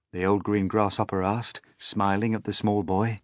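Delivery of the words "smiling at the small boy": ordinary delivery, nothing marked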